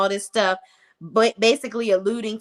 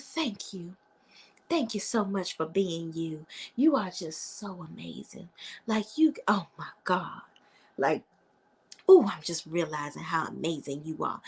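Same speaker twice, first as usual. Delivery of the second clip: soft, near-whispered gushing thanks; lightly voiced doting girlfriend; gushing gratitude; 3 semitones lower, 6 dB quieter than usual. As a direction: hush it like a tender secret. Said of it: "And she's like".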